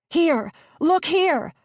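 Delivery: very high, loud scream. A narrator's reading. Gabby screaming over the motor, very high and loud. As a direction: pitch the scream over the engine. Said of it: "Gabby screamed over and over".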